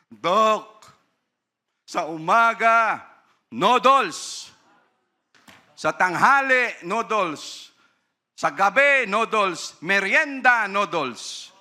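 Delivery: high, loud, pleading parent voice; parent pleading with doctor. Retold or imitated. Parent explaining to the doctor, high and pleading. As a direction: whine it out like a helpless parent.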